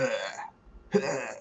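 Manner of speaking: low, quiet mock retching grunts; imitated retching. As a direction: fake a quiet gagging noise. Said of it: "a vomiting sound".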